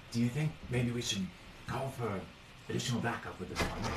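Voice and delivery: very soft, secretive voice; hushed conspirator. Acted. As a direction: ask it under your breath, covertly. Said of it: "they are very much whispering".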